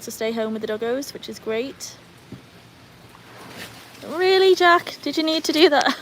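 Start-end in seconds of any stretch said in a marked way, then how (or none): 4.1-6.0 loud, high-pitched exasperated question; indignant scolding. Real, not acted. Owner to dog who pawed belly, indignant scolding.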